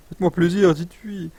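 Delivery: high-pitched mock begging; playful pleading. Begs chat to praise accent.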